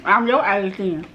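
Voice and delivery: ordinary talk, nothing marked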